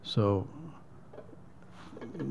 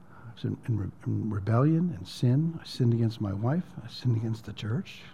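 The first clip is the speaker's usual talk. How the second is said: softly retold confession, lower and held back; retold tearful apology; 4 semitones lower, 8 dB quieter than usual. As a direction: quietly imitate a man near tears confessing. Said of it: "he was starting to cry".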